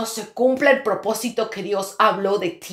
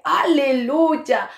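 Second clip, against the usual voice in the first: joyful hallelujah; 5 semitones higher, much slower than usual. Retold or imitated. Praising God after good news.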